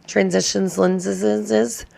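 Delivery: slow, stretched Transitions lenses with a long dragged lenses; mock dramatic slow repeat; drawn-out repeat. Shown as a demonstration. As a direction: savor "Transitions lenses" slowly, syllables drawn out. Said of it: "Like I'm in some Transitions lenses".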